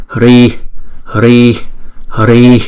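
"Hri" with a puff of breath on the h; "hri" aspirated, like a sigh; breathy hri. Shown as a demonstration. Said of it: "cái hơi chúng ta hà ra thôi".

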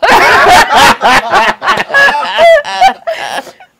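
long, high, loud cackling; real belly laugh. Real, not acted. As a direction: crack up completely at your own line. Cracks up at her own comeback.

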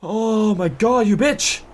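high, drawn-out shocked cry; jump-scare cursing, slow and high; startled outrage. Real, not acted. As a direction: stretch it out high after a real fright.

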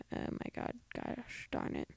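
frustrated whisper. Real, not acted. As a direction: whisper it in annoyance. Frustrated at hard name.

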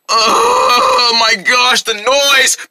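very slow, drawn-out cry of annoyance; exasperated wail. Acted. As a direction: drag it out, tormented by the noise. Noise drives character to distraction.

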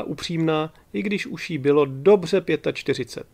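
usual speaking voice

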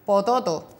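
put-on "pototo" said as a child's garbled version of tomate; child's mispronunciation. Therapist imitating a child mishearing tomate as "pototo". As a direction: say "pototo" with every syllable as an o. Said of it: "el niño te dice pototo".

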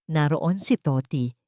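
ordinary delivery, nothing marked

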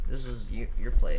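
shouted, exasperated; loud insisting. Real, not acted. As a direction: push the words out loud. Friend insists loudly.

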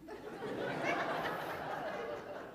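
a high unvoiced giggle of triumph; breathy gleeful laugh. Preacher teasing the flight attendant, high breathy laugh.

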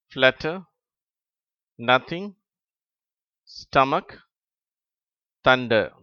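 rising run-on list; reading word list quickly. Shown as a demonstration. Teacher reading a new row to pupils. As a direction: read the words straight through. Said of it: "இடைவெளி விட்டு".

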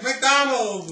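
put-on high, loud excited kid shout. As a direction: cheer it like a thrilled child. Kids shouting their dinner choice.

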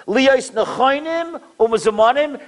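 measured, solemn recitation; slow text reading. Shown as a demonstration. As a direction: read the Hebrew very slowly. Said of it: "Listen to what the Satmar Rebbe read".

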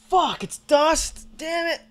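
frustrated whine. Real, not acted. Frustrated at dying in game.

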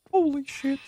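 high-pitched startled exclamation; sudden high yelp of surprise. Real, not acted. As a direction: squeal it out in shock. Startled by the game.

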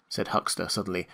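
ordinary delivery

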